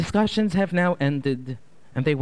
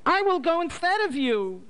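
desperate mother; 13 semitones higher than usual. A narrator's reading. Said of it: "she called out in a loud voice".

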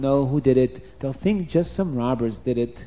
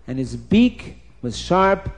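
usual speaking voice

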